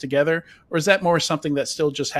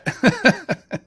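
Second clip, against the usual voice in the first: short laugh; 10 semitones higher, 4 dB louder than usual. Real, not acted. Laughing at own tongue twister.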